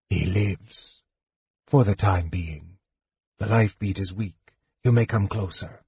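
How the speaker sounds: quiet, restrained wizard; hushed mage. A narrator's reading. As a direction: report it quietly, in short weary phrases. Mage reports on the king.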